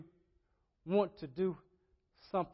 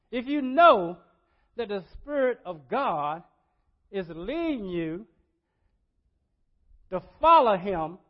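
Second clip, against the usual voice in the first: loud, raised preacher's delivery; preaching; 5 semitones higher, 12 dB louder than usual. Real, not acted. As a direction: drive each phrase home in bursts. Preaches at full force.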